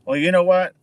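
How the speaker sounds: usual speaking voice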